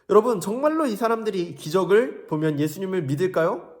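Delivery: ordinary delivery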